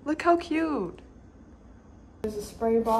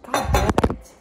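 frustrated curse; 9 dB louder than usual. Real, not acted. Fumbles rotating the set.

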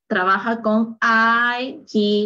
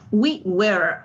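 American "We were" with the R sounded; American accent; 2 semitones lower than usual. Shown as a demonstration. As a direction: say "We were" the American way, finishing on a clear R. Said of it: "Aquí sí se pronuncia la R".

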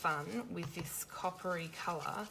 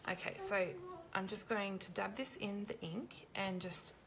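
ordinary talk, nothing marked

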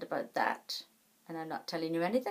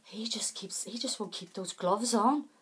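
whisper; 3 semitones higher than usual. Retold or imitated. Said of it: "Like, um".

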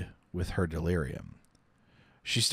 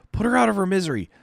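higher, louder harsh command; angry doctor; 11 semitones higher, 6 dB louder, much faster than usual. Acted. Doctor ordering Chaim, harsh angry shout. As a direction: order it angrily.

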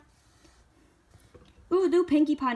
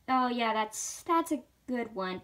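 ordinary delivery

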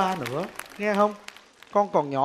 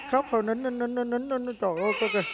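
soothing hush; 4 semitones higher, 7 dB quieter, faster than usual. Acted. Hushing a crying abandoned baby.

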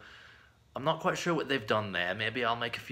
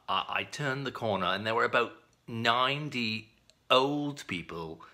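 usual speaking voice

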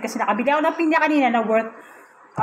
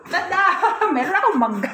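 laughing; 6 semitones higher than usual. Real, not acted. Laughing reveal of mango.

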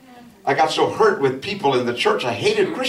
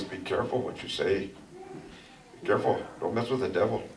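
lowered, hushed warning, much softer; mild hushed warning; 7 semitones lower, 12 dB quieter than usual. Retold or imitated. Brother warning the pastor quietly about the devil, hushed. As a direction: murmur it close like a cautious brother. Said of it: "He puts his hand, he go, he says".

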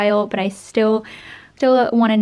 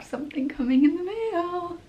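higher, giddy with anticipation; excited anticipation; 8 semitones higher, 5 dB quieter than usual. Real, not acted. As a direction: let the voice climb as you guess what's arriving. Postman arrives, anticipation.

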